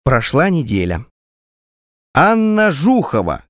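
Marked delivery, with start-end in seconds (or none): 2.1-3.4 higher, slow call of a name. A narrator's reading. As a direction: call the patient in from the waiting room. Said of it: "крикнул я". (громко вызывает пациентку)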